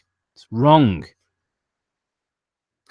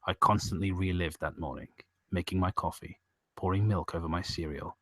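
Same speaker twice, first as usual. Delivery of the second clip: creepypasta story narration; 7 semitones lower, 7 dB quieter than usual. A narrator's reading. Narrator recalls a child's dreams of storms, sombre narration. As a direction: narrate a sombre tale, low and hushed.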